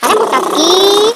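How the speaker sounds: slow nagging call across the house; drawn-out call. Acted. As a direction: drag the name out to rouse her. Calling to wake sleeper.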